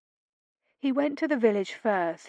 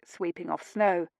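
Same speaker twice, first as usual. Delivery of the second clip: ordinary delivery, nothing marked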